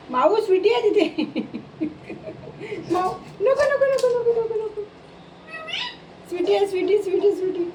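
high, urgent calls to the pet; excited calling. Real, not acted. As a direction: call the pet off, high and pressing.

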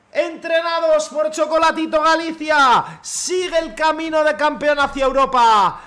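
grand post-goal commentary; triumphant commentary. Acted. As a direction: savor the goal like a caster.

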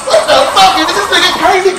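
ordinary talk, nothing marked

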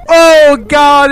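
exasperated wail. Acted. Exasperated outburst.

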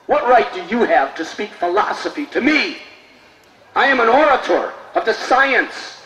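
loud, high, indignant shouting at the cook; outraged scolding. Acted. Proud orator shouting at the humble cook, outraged. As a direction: bellow down at him as a proud scholar.

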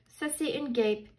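plain reading voice

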